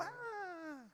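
thin, high mock wail; soft, very high-pitched imitation of a frightened baby; imitated baby cry. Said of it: "You're gonna go".

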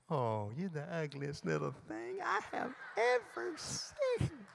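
slow, swooping high coo; sweet baby-talk voice. Shown as a demonstration. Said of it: "with a sweet little voice".

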